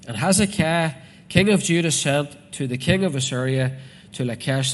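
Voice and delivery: declaimed scripture, names punched out; scripture reading. Acted. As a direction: proclaim the verse to the congregation. Reads scripture aloud to congregation.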